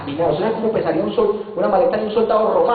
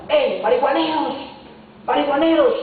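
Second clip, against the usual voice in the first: higher mock yell across the court; calling out; 7 semitones higher than usual. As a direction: hail the group from a distance. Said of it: "le grita". (grito burlón a los muchachos, más agudo)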